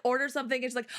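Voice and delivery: usual speaking voice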